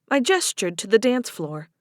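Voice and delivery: plain reading voice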